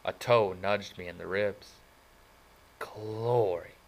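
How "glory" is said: rough voice. Acted. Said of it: "said a rough but familiar voice".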